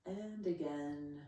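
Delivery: slow, unhurried yoga breathing cues; calm meditation pacing; guided relaxation. Real, not acted.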